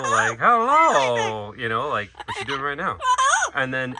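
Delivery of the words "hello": loud parrot hello. Retold or imitated. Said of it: "She does her normal".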